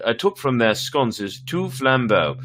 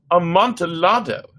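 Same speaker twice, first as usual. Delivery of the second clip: high and louder, eager; a wine lover who can't resist; rising thrill in the voice; excited exclamation; 10 semitones higher, 6 dB louder than usual. Acted.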